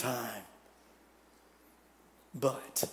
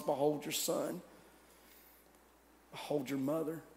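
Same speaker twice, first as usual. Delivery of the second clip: faint dying words; 3 semitones lower, 14 dB quieter than usual. Acted. Dying Jesus to his mother.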